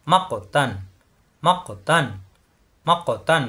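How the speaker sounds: মাক্ক তান with a bounce between the words; qalqalah bounce. Shown as a demonstration. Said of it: "বাড়ি খাবে".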